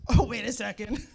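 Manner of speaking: loud, laughing disbelief; surprised reaction. Retold or imitated. Recalling Sharon's praise, startled laughing exclamation. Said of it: "my reaction was".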